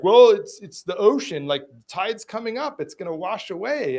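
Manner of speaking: loud, high mocking voice of the careless guy; dismissive excuse. Imitating the dog owner brushing off the complaint, mock dismissive. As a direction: play him off as a dismissive know-it-all. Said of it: "he's like".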